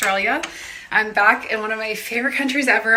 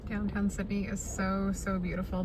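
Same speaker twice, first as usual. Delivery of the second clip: ordinary talk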